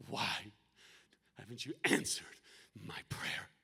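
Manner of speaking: low, halting plea, fading to a whisper; acting out frustration with God, word by word; anguished prayer.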